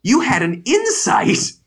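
imitating a stunned wife at her husband's insight; mock shocked Jill. Co-host imitates Jill stunned by Tim's insight, high mock shock. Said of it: "Jill is shocked".

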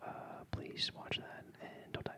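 whispered in-flight announcement; hushed airline voice; ASMR flight attendant. Pretend. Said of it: "That's the A S M R airline".

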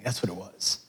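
breathy whispered aside; hushed aside to the congregation. Real, not acted. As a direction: drop it to a whisper.

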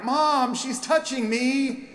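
put-on high whiny kid complaining to mom; whiny tattling little sibling. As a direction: tattle like a cranky six-year-old in the back seat. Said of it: "you can almost hear six-year-old Martha and four-year-old Mary".

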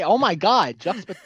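normal talking voice

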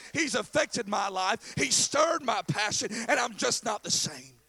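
loud, driving sermon delivery; full-force pulpit preaching; impassioned preaching. Real, not acted. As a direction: preach it hard, punching the key words.

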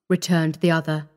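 ordinary delivery

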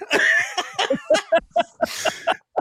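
high, breaking burst of laughter. Real, not acted. Builder joking about the name he gave, real laughing. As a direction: crack up at your own joke. Laughs at his own joke.

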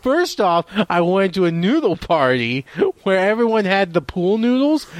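eager put-on comedian delivery; hammed-up excitement. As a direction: play up a wacky anecdote with mock enthusiasm. Hammed-up answer to "funny things" request.

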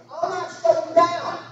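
high, loud, drawn-out protest; acted husband's indignant comeback; defensive denial. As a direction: deny it slowly in a strained high voice. Acted denial in marital quarrel.

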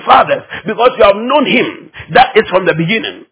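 ordinary delivery

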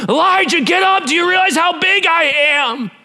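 high, thunderous shout of command; full-force indignant shout; yelling. Acted. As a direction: yell like an almighty God rousing someone. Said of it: "why wouldn't he just yell to him".

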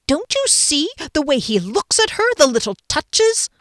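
high, urgent, incredulous question; agitated insistence. A narrator's reading. Friend squinting at him, agitated insistence. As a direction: press the point, pitch up.

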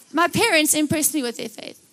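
normal talking voice

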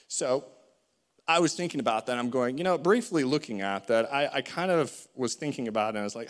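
usual speaking voice

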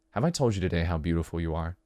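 low, soft romantic murmur; tender. Acted. Lover telling partner they are beautiful, tender and low.

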